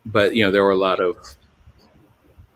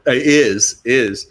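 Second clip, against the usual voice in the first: ordinary talk, nothing marked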